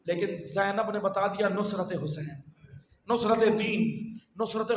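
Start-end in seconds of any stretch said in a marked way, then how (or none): none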